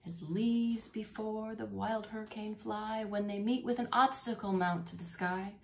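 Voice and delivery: put-on lively read-aloud for children; reading the poem to the class, with expression; storybook reading. Teacher reading Christmas poem to kids, animated storytelling.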